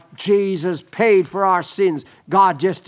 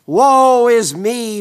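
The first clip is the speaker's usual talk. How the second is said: crying out in woe; 5 semitones higher, 7 dB louder, slower than usual. Acted. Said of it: "We cry out as Isaiah did".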